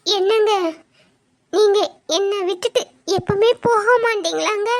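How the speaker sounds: tearful, halting plea; broken phrases with sobbing pauses; crying. Acted. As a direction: beg your husband through tears. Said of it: "அக்கா ஏன் அழுதுகிட்டு இருக்கா?".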